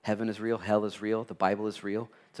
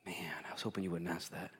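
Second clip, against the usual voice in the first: whispered, guilty admission; answering God, caught out; 4 semitones lower, 13 dB quieter than usual. Retold or imitated. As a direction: breathe it out sheepishly. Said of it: "and you say".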